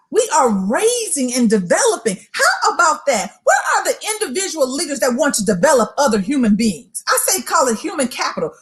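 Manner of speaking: usual speaking voice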